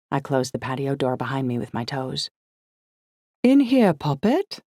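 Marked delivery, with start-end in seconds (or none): 3.4-4.6 Liz calling out in a put-on British accent, higher; affected English lilt, called from another room; posh English accent. A narrator's reading. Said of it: "in her manufactured aristocratic English accent".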